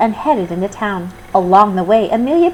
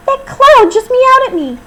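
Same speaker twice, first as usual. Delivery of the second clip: much higher, louder, astonished; can't believe the cloud meowed; amazed; 8 dB louder than usual. Acted. Character amazed by meowing cloud.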